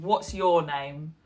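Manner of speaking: slow, low, blunt question; unwelcoming tone. Shown as a demonstration. Teacher imitating someone cold, blunt and unfriendly. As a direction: ask it coldly. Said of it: "it feels a bit unwelcoming".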